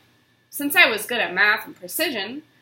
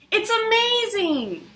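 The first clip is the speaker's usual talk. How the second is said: much higher, slow, wide-eyed wonder; amazed; 11 semitones higher, slower than usual. Acted. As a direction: gasp it out like a thrilled little boy. Young George admires brother's uniform.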